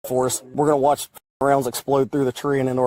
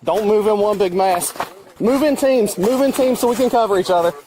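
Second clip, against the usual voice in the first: raised, high urgent commands; urgent orders; 6 semitones higher than usual. Real, not acted. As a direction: direct the team urgently. Directing team during shootout.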